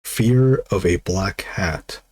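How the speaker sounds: title said again very slowly; slow repeat. Shown as a demonstration. To Brunson, repeating the movie title slowly. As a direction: spell the title out, word by word. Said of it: "have you ever seen the movie".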